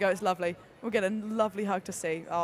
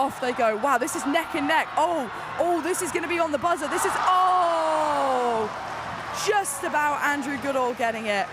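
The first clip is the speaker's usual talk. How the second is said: louder, rising excitement through a close finish; breathless thrilled commentator, almost overwhelmed; excited race call; 4 semitones higher, 5 dB louder than usual. Real, not acted. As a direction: call the finish like it's on the buzzer. Live race finish, neck and neck.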